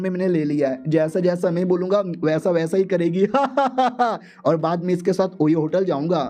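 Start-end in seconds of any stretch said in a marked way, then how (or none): 3.3-4.2 high, gloating stage laugh; villain laugh. Acted. Villain gloats over mind-controlled girl. (खलनायक की बनावटी हँसी)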